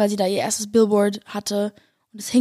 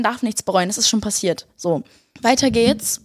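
usual speaking voice

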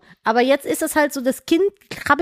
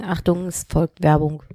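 announcer voice; 4 semitones lower, slower than usual. Pretend. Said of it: "hast du jetzt getrunken?".

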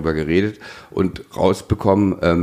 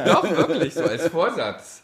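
laughing tease; 10 semitones higher than usual. Real, not acted. Teasing joke about intro resolution.

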